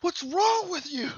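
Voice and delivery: high, drawn-out mock scream; hammed-up exasperated yell; mad captive. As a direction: wail it in outraged falsetto. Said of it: "he's just so mad".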